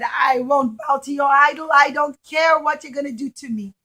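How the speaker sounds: loud defiant shout; defiant son. Acted. Son defying the king, loud and defiant. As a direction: stand up to him loudly.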